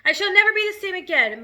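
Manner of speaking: ordinary delivery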